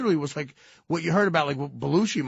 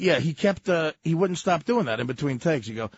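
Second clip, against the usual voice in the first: normal talking voice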